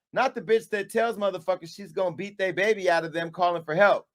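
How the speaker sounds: usual speaking voice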